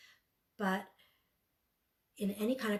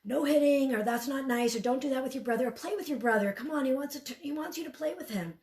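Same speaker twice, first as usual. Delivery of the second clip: louder, a bit higher, scolding tone; typical scolding parent; 6 semitones higher, 5 dB louder than usual. Shown as a demonstration. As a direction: snap out the usual parent commands. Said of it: "would typically want to say".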